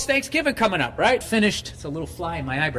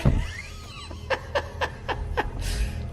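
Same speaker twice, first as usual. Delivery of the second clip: high-pitched burst of laughter; 6 dB quieter than usual. Real, not acted. Laugh alone on line.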